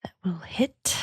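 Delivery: soft unvoiced whisper. Real, not acted. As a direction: murmur it under breath. Whispered aside.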